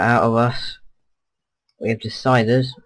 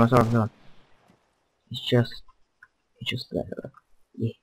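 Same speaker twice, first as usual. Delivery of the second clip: ordinary talk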